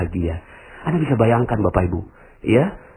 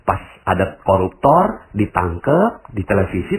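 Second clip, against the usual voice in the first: normal talking voice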